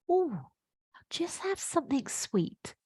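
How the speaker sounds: slow, breathy whisper of a craving; the sweet tooth whispering, slow and secret; whispered inner voice. Pretend. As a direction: hush it like a tempting thought in your head. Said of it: "you hear that little voice".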